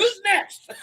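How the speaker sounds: high, loud laughing call-out; gleeful challenge. Real, not acted. Egging on more offending.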